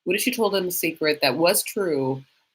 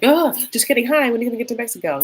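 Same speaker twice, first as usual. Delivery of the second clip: higher, rushed fake-cheery greeting; mock chirpy backtrack; 5 semitones higher, faster than usual. Host imagining a caller backtracking at once, mock-cheery and fast. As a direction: pretend a perky, hurried change of heart.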